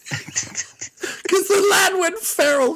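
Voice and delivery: words squeezed out through laughter, slowed; laughing. Real, not acted. Host telling co-host about the feral lad, laughing. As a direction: laugh it out as you say it.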